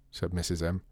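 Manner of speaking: usual speaking voice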